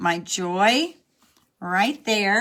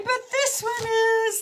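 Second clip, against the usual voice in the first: pleased discovery; 14 semitones higher than usual. Real, not acted. Found the right card.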